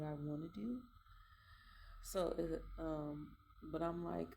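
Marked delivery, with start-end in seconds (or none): none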